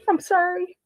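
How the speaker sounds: choked-up apology after a long sob; tearful, barely holding it together; real crying. Real, not acted. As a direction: voice breaking high through tears. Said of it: "Oh my God, I'm gonna cry".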